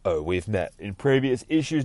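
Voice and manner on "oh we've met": ordinary delivery, nothing marked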